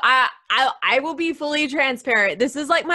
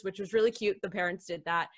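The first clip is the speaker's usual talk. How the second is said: normal talking voice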